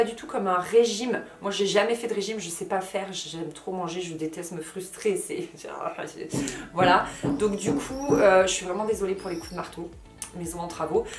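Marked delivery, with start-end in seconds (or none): 5.5-6.7 soft breathless chuckle, lower and quieter; a little embarrassed laugh under the breath. Real, not acted. Petit rire après une pause. (petit rire soufflé, plus doux)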